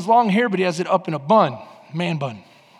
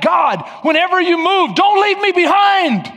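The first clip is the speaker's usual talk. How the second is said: high, loud plea, slowing at the end; desperate shouted prayer; fervent shouted prayer; 9 semitones higher, 4 dB louder than usual. Pretend. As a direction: cry out to God not to be left. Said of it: "you gotta say things like".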